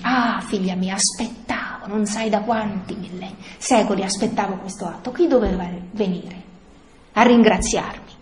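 quiet, loving and patient; tender Jesus voice. Acted. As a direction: speak it softly, like a father to a beloved daughter. Jesus tenderly speaking to Luisa.

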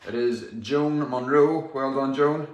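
upbeat raffle draw call; raffle caller. Acted. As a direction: announce the draw to the stream. Winner announcement.

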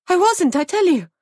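high, loud indignant protest; cornered woman protesting; vehement denial. A narrator's reading. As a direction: insist at the top of the voice. Denies being the schoolteacher.

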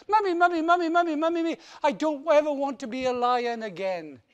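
loud, high cry for Mummy; frantic child. Acted. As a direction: wail for your mum. Child runs crying to mum.

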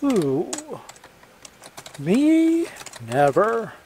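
high, feigned innocent protest; mock innocence. A narrator's reading. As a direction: play the innocent, hand on heart. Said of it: "pressed his fingertips to his chest".

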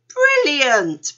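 loud, high, drawn-out exclamation; delighted cry. A narrator's reading. Panda gets a new idea, delighted cry. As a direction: cry it out with glee. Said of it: "Panda cried".

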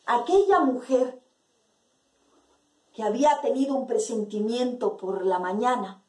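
usual speaking voice

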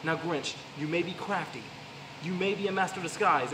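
normal talking voice

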